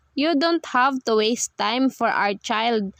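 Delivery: ordinary delivery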